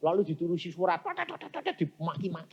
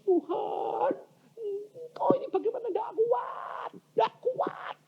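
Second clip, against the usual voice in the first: high, tearful mock wail; acted crying. Preacher acting out a tearful prayer to God, wailing. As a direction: wail helplessly, stretching the words. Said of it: "Lalu ku nangis".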